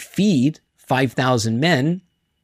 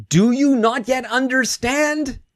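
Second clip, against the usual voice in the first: much higher, slow exasperated question; exasperated scolding; 10 semitones higher than usual. Retold or imitated. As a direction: drag it out like a frustrated teacher. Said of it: "He's, he's scolding them".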